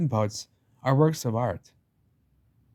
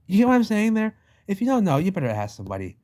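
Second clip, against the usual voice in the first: emphatic outburst; 8 semitones higher, 4 dB louder, faster than usual. Real, not acted. Emphatic point to viewers.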